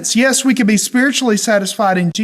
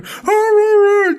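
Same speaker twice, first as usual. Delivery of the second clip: high, sustained happy dog howl; excited dog; slower than usual. Retold or imitated. Dog greets owner coming home, excited high howl. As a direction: imitate a dog thrilled to see you. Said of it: "She's so excited".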